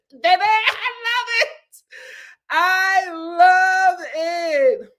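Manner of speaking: high, loud, stretched-out cry of love; gushing delight. Real, not acted. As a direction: gush it, dragging the words way out. Gushing over a viewer comment.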